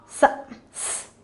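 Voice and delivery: "s" said as a long hiss, not the letter name; letter sound. Shown as a demonstration. Teacher shows children what S sounds like, letter sound. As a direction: sound out "s" as a drawn-out sss. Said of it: "and it sounds".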